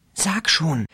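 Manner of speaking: urgent whisper. A narrator's reading. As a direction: push him in a whisper. Rachel urging, whispered.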